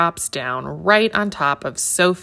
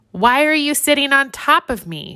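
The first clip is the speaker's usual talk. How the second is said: higher, a light playful monkey yell; mild mock shout; 8 semitones higher than usual. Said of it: "shouts Baba".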